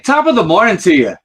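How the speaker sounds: high, loud stage-Irish greeting; Irish accent. Shown as a demonstration. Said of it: "if you were to go".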